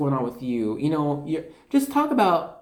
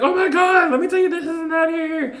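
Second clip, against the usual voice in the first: much higher, louder gushing voice; excited spirit; 14 semitones higher, 4 dB louder than usual. Retold or imitated. Spirits excited to tell the reader everything, high gushing. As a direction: gush like a thrilled spirit spilling news. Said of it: "really excited to be like".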